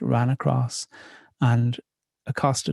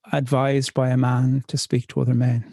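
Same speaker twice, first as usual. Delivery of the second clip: usual speaking voice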